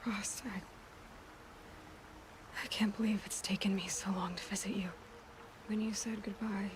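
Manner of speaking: soft, breathy half-whisper to the dead; quiet, intimate farewell whisper; hushed graveside whisper. Acted. Speaking softly at Rost's grave.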